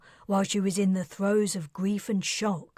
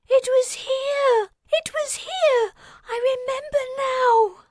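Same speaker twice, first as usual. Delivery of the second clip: very high, loud sobbing cry; distraught child; 7 dB louder than usual. Acted. Mickey crying out in distress, very high child's voice. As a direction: cry out in grief. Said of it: "mumbling and sobbing".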